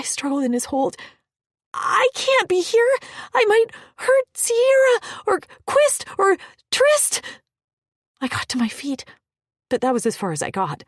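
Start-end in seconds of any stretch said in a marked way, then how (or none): 1.7-7.2 high desperate pleading; panicked. Acted. Struggling in his hold.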